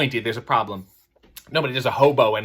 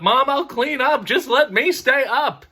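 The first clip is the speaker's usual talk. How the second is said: put-on high, slow kid begging; mock kid begging mom to stay up; pleading kid; 11 semitones higher, 3 dB louder, slower than usual. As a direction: wheedle for a later bedtime.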